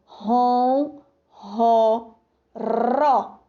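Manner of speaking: ron, ro, ró split into separate syllables; syllables spelled out. Shown as a demonstration. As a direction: break ronroso into ron, ro, ró. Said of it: "A letra R foi pronunciada da mesma forma". (sílabas separadas ron, ro, ró)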